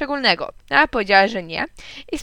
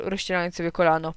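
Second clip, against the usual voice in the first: ordinary delivery, nothing marked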